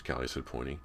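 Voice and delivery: ordinary delivery, nothing marked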